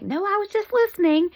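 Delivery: high, imitated motherly protest; mock-defensive mom, voice jumping high. Mom defends herself to daughter.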